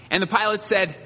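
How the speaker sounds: ordinary talk, nothing marked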